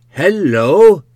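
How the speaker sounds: loud high call from across the room. Acted. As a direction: holler a drawn, swooping hello. Calls from the tap.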